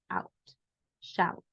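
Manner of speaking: "out" and "shout" with the ou sounding like "aut"; English ou sound. Shown as a demonstration. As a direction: say "out" and "shout" with a clear ou glide. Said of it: "se dice así".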